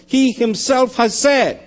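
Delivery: "He himself" pitched high, drawn out; stress on HE HIMSELF. Shown as a demonstration. Preacher showing who makes the statement, emphatic. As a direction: punch "He himself" high and slow. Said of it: "The emphasis on who is making the statement".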